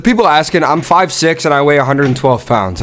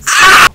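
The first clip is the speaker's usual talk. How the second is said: yell; 14 semitones higher, 6 dB louder than usual. Real, not acted. Reacting to a clip.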